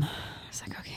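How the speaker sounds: whispered, voiceless retort; muted resignation. Retold or imitated. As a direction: let it out under your breath, very soft. Swallowing her reaction to his insult.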